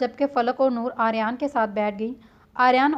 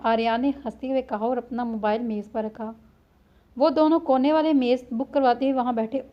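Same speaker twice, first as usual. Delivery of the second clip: usual speaking voice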